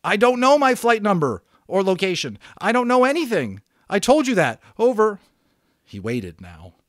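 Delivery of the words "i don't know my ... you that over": much higher, much louder exasperated reply; frustrated shout. Acted. Boy shouting back that he knows nothing, exasperated. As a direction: snap back, fed up and scared.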